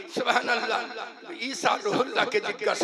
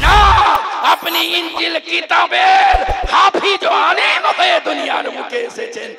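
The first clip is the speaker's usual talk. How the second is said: loud, high fervent sermon; full-force preaching; 5 semitones higher, 6 dB louder than usual. Acted. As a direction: preach it out to the crowd.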